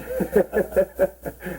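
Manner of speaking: short chuckle, falling away; light laugh. Real, not acted. Laughs after whale of appetite joke.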